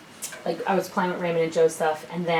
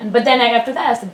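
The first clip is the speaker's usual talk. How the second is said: ordinary talk, nothing marked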